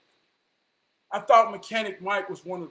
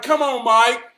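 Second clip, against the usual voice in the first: exasperated; 6 semitones higher, 11 dB louder than usual. Retold or imitated. Disbelief at pepper advice.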